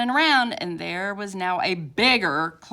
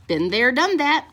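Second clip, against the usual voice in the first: moan; 4 semitones higher, 7 dB louder than usual. A narrator's reading. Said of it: "moaned Oliver".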